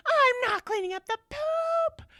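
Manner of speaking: mother mimicking her daughter's screamed refusal; imitated teen yelling. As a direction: wail the refusal like a sulking teen. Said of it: "she kept yelling".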